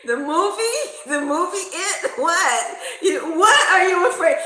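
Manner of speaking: high, loud, laughing disbelief; cracking up while asking. Retold or imitated. Teller teasing her anxious friend, loud and laughing.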